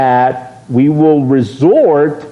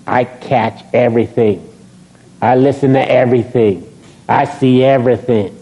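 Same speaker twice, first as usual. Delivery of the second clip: usual speaking voice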